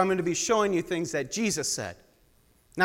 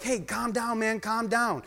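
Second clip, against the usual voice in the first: soothing calm down; 4 semitones higher, 5 dB quieter, slower than usual. Retold or imitated. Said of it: "and I'm like".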